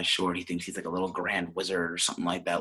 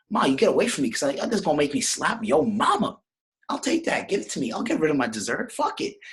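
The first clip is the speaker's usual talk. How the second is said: excited, higher-pitched son, hammed up; Nicky; 5 semitones higher, 3 dB louder than usual. Son jumps at his ma's dinner offer, hammed-up excitement. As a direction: go over the top accepting the offer. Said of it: "And Nicky goes".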